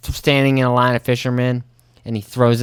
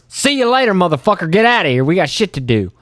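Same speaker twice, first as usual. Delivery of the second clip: brash send-off; 6 semitones higher than usual. Retold or imitated. Drive-through worker sending customer off.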